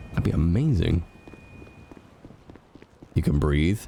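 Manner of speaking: normal talking voice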